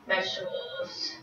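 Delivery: ordinary talk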